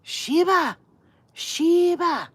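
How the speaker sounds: high, loud shout across the yard; yelling for husband. Retold or imitated. Neighbor screaming for her husband Sheba, high and loud. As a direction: scream for your husband to come out. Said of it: "she calls for her husband".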